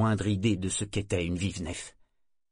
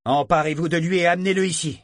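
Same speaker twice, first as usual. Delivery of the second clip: ordre rapide, fort et aigu; ordre mécontent; 8 semitones higher, 7 dB louder, faster than usual. Acted. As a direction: ordonne avec irritation. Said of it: "ordonna Saadar ... d'un air mécontent". (ordre mécontent)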